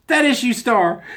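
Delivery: high, loud, tickled exclamation; really delighted, pitched high; delighted praise. Real, not acted. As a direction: cry out in amused admiration. Delighted aside amid laughter.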